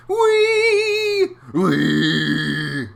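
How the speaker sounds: very high sung whee; gleeful singsong whee; joyful whee. Acted. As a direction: squeal with fun. Fish swimming fast.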